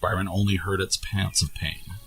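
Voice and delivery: ordinary delivery, nothing marked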